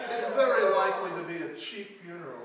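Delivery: loud high laughing talk; loud mocking laughter. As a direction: laugh heartily through it.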